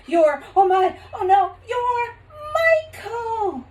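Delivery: high, loud, crying out; shocked realisation. Acted. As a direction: gasp as you recognise him. Mother recognises son, cries out.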